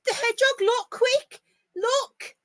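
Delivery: urgent, high and eager; excited. Real, not acted. Vlogger urging family to look at the hedgehog, eager and high. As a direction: point it out fast before it goes. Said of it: "I got a bit excited then".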